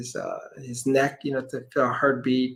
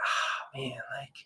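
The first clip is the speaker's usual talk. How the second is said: hushed reluctant aside; 12 dB quieter, slower than usual. Retold or imitated. Reluctant aside about being asked.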